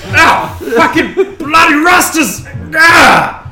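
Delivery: high, loud agonized cursing; wounded half-orc roaring at full volume; pained bellow. Acted. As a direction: yell out curses in pain. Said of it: "There were bellows".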